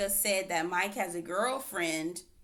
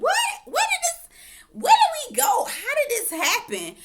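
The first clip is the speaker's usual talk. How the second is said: much higher, louder bewildered cry with a laugh; shocked disbelief; 7 dB louder than usual. Retold or imitated. Reacting to the sudden fight, high shocked disbelief. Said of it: "And I'm like".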